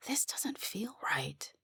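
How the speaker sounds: whispered under the breath, slow; barely voiced, slow and to yourself. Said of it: "I said under my breath".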